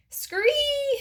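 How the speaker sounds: very high, long scree; shrill frog cry, held out; yelled frog screech. A narrator's reading. Frog carrying the hamster, yelling a screech. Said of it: "he yelled".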